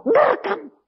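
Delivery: very high teacher voice; put-on high-pitched teacher; imitated teacher. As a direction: mimic the teacher in a high, altered voice. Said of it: "Gurune ngomong".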